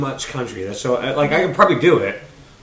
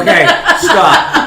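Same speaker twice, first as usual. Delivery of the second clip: laughing; 5 semitones higher, 7 dB louder, much slower than usual. Real, not acted. Laughing protest.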